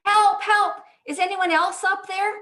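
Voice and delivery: high, loud punchline cry; acted yell for help. Man on the cliff after God's advice, yelling for help. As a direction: shout past the first helper for someone else. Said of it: "we hear Jack yell".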